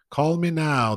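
high Jamaican ad voice; Miss Cleo impression. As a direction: mimic a TV psychic pitch. Quoting Miss Cleo ad line.

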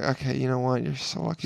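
soft imitation of a woman lover; imitated bedroom murmur. Woman's bedroom reply acted out.

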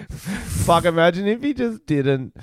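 giggling through the words; laughing. Real, not acted. Laughing at the drug boss disguise.

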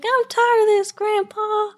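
put-on high, drawn-out Southern girl voice; Southern accent. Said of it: "just did the really bad kind of Southern accent".

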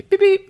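high, loud imitated phone beep. Imitating his phone's text alert, high beeping.

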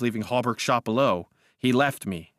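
usual speaking voice

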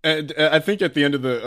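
normal talking voice

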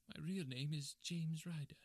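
high, slow little-man voice; high-pitched, measured confession; confessing little man. Acted. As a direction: admit it reluctantly in a high voice. Little man confesses real name.

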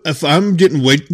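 normal talking voice

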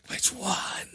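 harsh whispered yell. Pretend. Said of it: "Start whisper yelling".

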